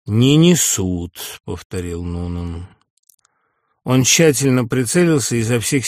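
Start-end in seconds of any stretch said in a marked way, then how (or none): 0.1-1.4 very slow drawn out Не несут; a slow echo repeating his words; slow mocking repeat. Nunan repeats slowly, mocking.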